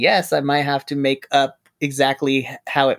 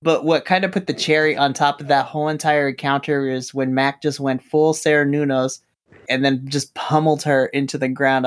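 ordinary talk

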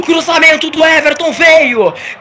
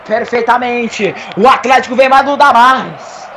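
ordinary talk, nothing marked

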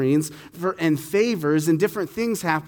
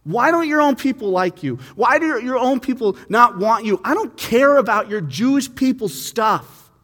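annoyed Pilate; 8 semitones higher, 6 dB louder than usual. Retold or imitated. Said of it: "you'd say".

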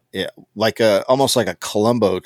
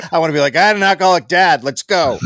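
higher, louder and fast, ready for a fight; mock fired-up challenge; 7 semitones higher, 5 dB louder, faster than usual. Guard itching for a confrontation, fired-up mock challenge. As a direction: pump yourself up for a scrap. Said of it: "he's like".

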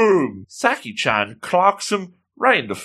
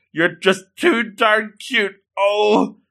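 high-pitched gushing, acted; hammed-up melting at cuteness; struggling to resist; 8 semitones higher than usual. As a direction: draw it out high, as if giving in. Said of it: "And she's struggling".

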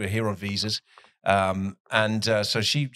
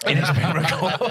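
laughing; 9 semitones higher than usual. Real, not acted. Teasing reminder about recording.

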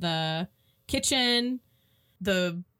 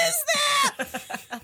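much higher, startled voice; mock spooked. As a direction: squeak out the question in fright. Retelling viewer's reaction to claw.

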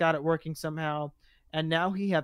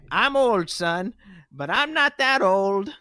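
put-on slow, higher, worn old man; 3 semitones higher, 6 dB louder, slower than usual. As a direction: stretch it out like a tired old cop. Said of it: "reread Leo's line".

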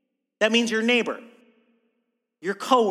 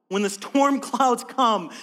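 ordinary delivery

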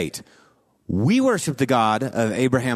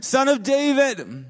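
pleading cry; 8 semitones higher than usual. Retold or imitated. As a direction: cry out to him for mercy. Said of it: "this woman starts lifting up her voice".

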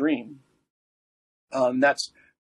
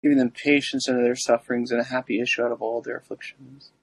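prayer. Acted. Recites the litany.

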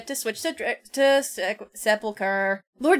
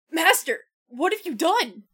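shaky horrified; 7 semitones higher than usual. Acted. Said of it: "shakily holding his sword".

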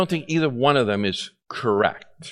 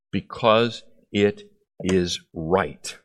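slow repeat; 6 semitones lower, much slower than usual. Acted.

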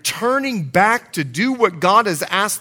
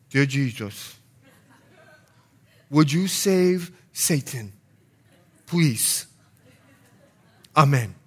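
put-on daughter's Bahamian accent; 3 semitones lower, 4 dB quieter than usual. Father imitating his five-year-old daughter's prayer, Bahamian accent. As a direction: softly imitate a small child's Bahamian accent. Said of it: "let me put it in her accent".

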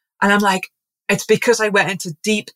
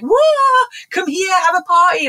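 sudden very high, louder whoop of delight; excited party call; 11 semitones higher, 3 dB louder than usual. Retold or imitated. Plants inviting humans to their party, squealing high with glee. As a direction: burst out like plants throwing a party. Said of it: "We're already showing you by our growth, like".

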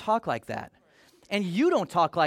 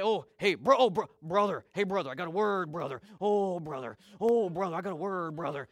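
churchy insider talk. Retold or imitated. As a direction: lightly ham up a churchy "brother" voice. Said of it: "That's insider language".

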